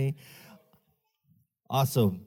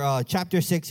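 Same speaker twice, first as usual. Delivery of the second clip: ordinary delivery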